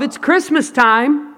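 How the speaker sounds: gentle calling out, pitched high; calling from above. Retold or imitated. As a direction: coax the hidden child out. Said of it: "as he called from above".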